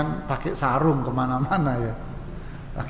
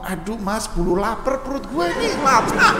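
impatient Betawi; 6 semitones higher than usual. Acted. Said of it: "Betawi, ngelihat orang Solo ngomong".

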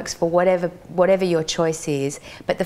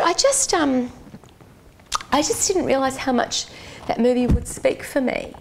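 higher, halting imitation of a moved woman; retold tearfulness; 6 semitones higher than usual. Tearful woman confiding how the movie spoke for her, retold. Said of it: "She's wiping tears away".

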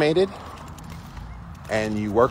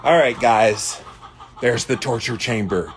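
usual speaking voice

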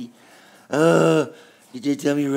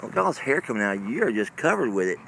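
normal talking voice

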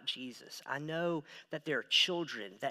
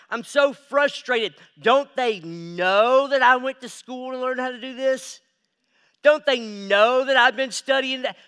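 loud, higher, exasperated outburst; frustrated rant; 8 semitones higher, 12 dB louder than usual. Retold or imitated. Young pastor venting at home about church pushback, loud and exasperated. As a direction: vent like nobody listens to you.

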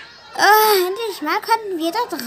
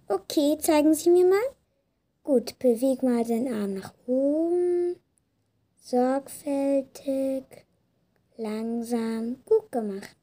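calm doctor voice; 6 semitones lower, 4 dB quieter, slower than usual. Acted. Doctor calmly guides patient.

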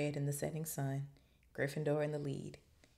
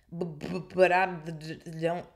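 nervous stuttering; 6 dB louder than usual. A narrator's reading. Quirrell cornered by an impatient Snape, stuttering. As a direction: stammer on nearly every word. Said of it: "he was stuttering worse than ever".

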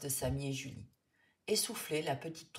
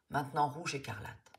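ordinary delivery

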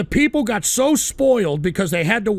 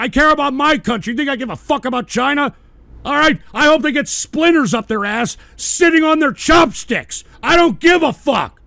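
higher, louder angry yelling; real furious shouting; 6 semitones higher, 5 dB louder than usual. Real, not acted. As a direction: blast it out in genuine outrage. Furious rant continues.

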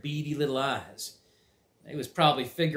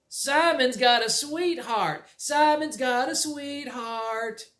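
slow, raised teasing chant; singsong taunt; 11 semitones higher, slower than usual. Acted. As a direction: tease him like a schoolyard kid.

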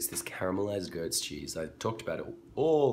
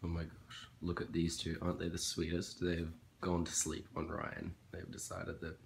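hushed; 8 dB quieter than usual. Real, not acted. Dogs asleep on Ryan.

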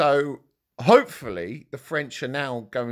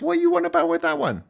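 incredulous; much faster than usual. Real, not acted. Incredulous at a viewer comment.

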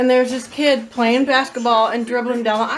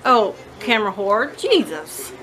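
ordinary talk

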